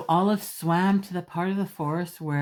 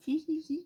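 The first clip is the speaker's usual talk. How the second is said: high giggle; 13 semitones higher, 4 dB quieter than usual. Acted. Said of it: "Olive giggled".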